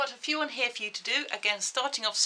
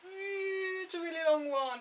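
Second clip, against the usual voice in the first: high, whiny mock dismay; mock whimper; 10 semitones higher than usual. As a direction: whimper at how long it is. Daunted by a long sum.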